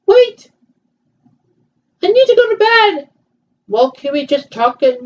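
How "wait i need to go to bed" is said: high panicked pleading. Pretend. Pleading with pretend police officer.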